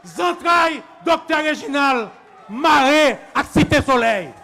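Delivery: loud, emphatic declamation; shouted slogan. Real, not acted. Doctor declaring his tie to Cité Soleil, loud rallying cry. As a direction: hurl each phrase out to the crowd.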